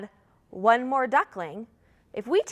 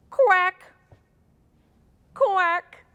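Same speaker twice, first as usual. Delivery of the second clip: loud, higher duck quack; 5 semitones higher, 4 dB louder than usual. Shown as a demonstration. Teacher shows children how to quack along, loud duck quack. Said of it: "we're gonna quack some more, like this".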